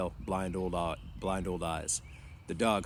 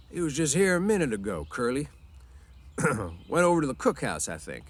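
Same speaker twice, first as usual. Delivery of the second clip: higher, louder answer, tailing off; helpful old swamper; 6 semitones higher, 5 dB louder than usual. A narrator's reading. Old swamper answering the boss's son, helpful. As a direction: tell him where the boss went.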